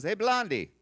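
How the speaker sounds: high, loud call across the path; little Greek lady. Retold or imitated. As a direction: hail a passer-by from a doorstep. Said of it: "this little lady ... she goes".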